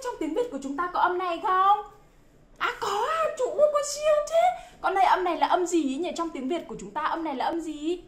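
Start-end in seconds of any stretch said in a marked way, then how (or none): 2.6-4.7 very high, gushing mock amazement; amazed praise. Teacher cheering a child who knows the sound, amazed praise. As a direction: gush over a child's answer in a high sing-song.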